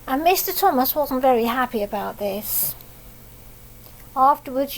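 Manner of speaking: ordinary delivery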